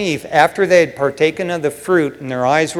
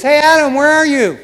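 God calling out for Adam, higher and louder; mock God calling out; 9 semitones higher, 4 dB louder than usual. Preacher voicing God calling for hiding Adam, raised call. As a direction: call out like God searching the garden.

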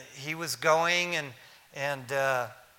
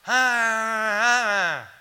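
louder, higher wordless drone, straining to be understood; imitated loud moan through oxygen mask; 7 semitones higher, 9 dB louder, much slower than usual. Dying dad repeating himself through the oxygen mask, loud muffled moaning. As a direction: mimic garbled speech as one long loud "aah". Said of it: "pulled the oxygen, oxygen thing off".

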